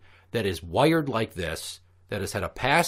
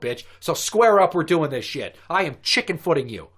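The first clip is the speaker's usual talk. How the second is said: mock rage; 7 semitones higher, 5 dB louder than usual. Acting out fight club rage.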